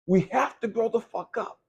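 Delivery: ordinary talk, nothing marked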